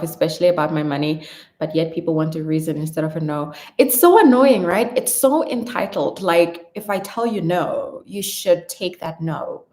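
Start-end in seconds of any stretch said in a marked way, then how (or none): 3.8-6.2 annoyed. Real, not acted. Ranting about reasons.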